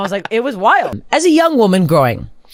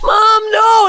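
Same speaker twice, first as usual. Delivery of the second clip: put-on high, loud child's protest to mom; imitated kid wailing in protest; mock horrified yell; 5 dB louder than usual. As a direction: cry out like an appalled kid, hammed up. Child protesting to her mother, retold.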